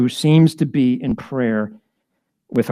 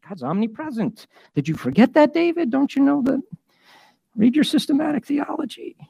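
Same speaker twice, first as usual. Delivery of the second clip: higher, mocking lecture voice; mock know-it-all; 10 semitones higher, 3 dB quieter than usual. Preacher mocking a theologian scolding David, higher and sneering.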